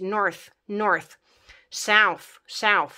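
"North" and "South" each repeated, ending in dental "th"; th with tongue between teeth. Shown as a demonstration. Teacher drilling the th ending, careful isolated words. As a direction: model "North, South" as separate words with a clear final "th". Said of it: "la north și south bagi limba între dinți".

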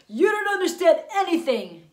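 loud, high outburst of exasperation; acted lovers'-quarrel outburst; exasperated boyfriend.

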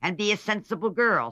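put-on loud, high, imposing old aunt; impressive old lady. A narrator's reading. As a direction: lay down the law as a rich old aunt. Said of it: "said the old lady impressively".

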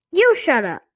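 loud, high puppet retort; tight high-pitched dummy. A narrator's reading. Dummy answers back to the sister, tight high-pitched retort. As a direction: snap back in a tight squeaky voice. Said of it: "in Lindy's tight, high-pitched voice".

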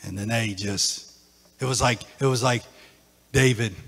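hushed after-climax teaching; quiet reflective preaching. Acted. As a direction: bring them down gently.

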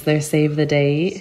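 usual speaking voice

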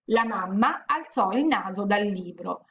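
usual speaking voice

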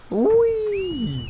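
high drawn-out squeal of glee; gleeful whee. Real, not acted. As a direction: let out a long, high-pitched "whee". Gleeful cry during the game.

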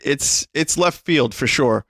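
ordinary talk

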